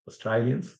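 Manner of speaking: Aussie "Australians" with "stray" as "stry"; Australian accent. Shown as a demonstration. Teacher repeating the Aussie way for students, Australian accent. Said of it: "that's very typical Aussie".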